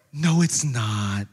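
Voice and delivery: low, slow acted officer; reassuring officer. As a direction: draw it out low and patient. Acting the officer reassuring him.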